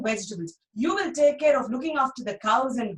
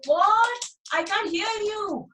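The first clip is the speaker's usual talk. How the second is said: higher, louder, over the noise; busy mother; 9 semitones higher, 4 dB louder, slower than usual. Acted. Mother shouting back to Dhani while cooking. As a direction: call out over the cooking fire. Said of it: "she was like".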